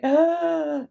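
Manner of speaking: long, high imitated gasp; acted-out sharp intake of panic; mock panicked gasp. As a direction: gasp in pretend horror. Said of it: "And she panicked".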